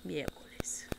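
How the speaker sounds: Yeah, miércoles whispered softly. Shown as a demonstration. Savouring favourite Spanish word, whispering miércoles. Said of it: "at least it sounds nice".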